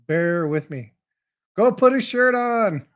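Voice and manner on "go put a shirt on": raised voice, higher and louder; calling out. Real, not acted. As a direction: call across the room to someone. Calling out to someone nearby.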